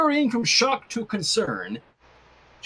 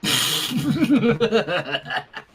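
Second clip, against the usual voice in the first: laugh. Real, not acted. Laughs at joke.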